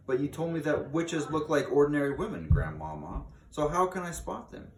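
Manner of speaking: put-on a touch of a worried child; young boy asking.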